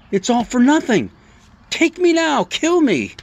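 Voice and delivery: high-pitched, hammed-up despairing plea; despairing Elijah. Elijah begging God to end it, high mock despair. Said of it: "curls up in a ball and says".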